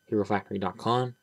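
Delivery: ordinary talk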